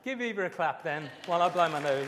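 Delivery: loud call to the whole room, half laughing; rally the room to clap for Eva; calling out loudly. Real, not acted. Preacher calls the congregation to clap for Eva, loud call out.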